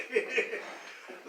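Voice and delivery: airy wheezing laugh; breathy laugh. Real, not acted. Storyteller laughing about being left standing.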